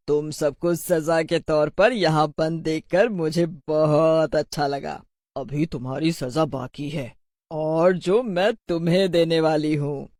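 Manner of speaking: gloating witch. Acted. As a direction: savour the threat, stretching words.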